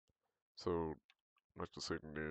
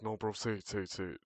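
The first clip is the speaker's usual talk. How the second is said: louder, insistent mock badgering; pestering; 3 semitones higher, 5 dB louder than usual. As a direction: imitate someone nagging, repeated rapid demands. Retelling peers badgering him.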